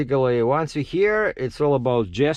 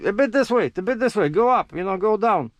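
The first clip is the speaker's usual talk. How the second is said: raised, higher mock shout of directions; retold shouting directions; 8 semitones higher than usual. Guiding the excavator guy from the top, raised mock shout. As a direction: call the moves up to the operator below. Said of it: "I'm on the top shouting to him".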